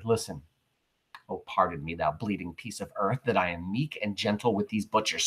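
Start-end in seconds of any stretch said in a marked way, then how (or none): 1.3-5.2 soft, low murmured apology; hushed, lowered soliloquy reading; apologetic. Acted. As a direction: speak low over a dead friend. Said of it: "now he's apologizing to the dead Caesar".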